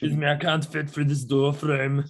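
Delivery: lightly done Austrian accent, politely asking; a hint of a polite Arnold, in Austrian English. Shown as a demonstration.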